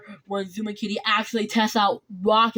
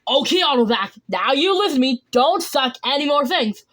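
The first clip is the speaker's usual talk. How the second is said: higher, louder telling-off; firm, raised-voice order; scolding; 5 semitones higher, 5 dB louder than usual. Acted. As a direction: lay down the law to a misbehaving gadget. Character scolds a vacuum in story.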